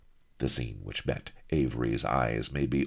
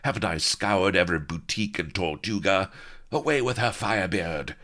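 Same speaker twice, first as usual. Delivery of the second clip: raised, exasperated villainess order; complain, then call out the command higher; growled; 5 semitones higher than usual. A narrator's reading. Pirate woman ordering her henchman to remove captive, growling. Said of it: "growled Sheba".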